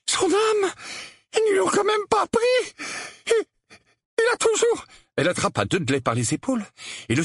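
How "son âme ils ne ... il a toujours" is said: very high, panicky aunt; alarmed cry. A narrator's reading. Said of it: "laissa échapper un petit cri". (tante affolée, voix suraiguë)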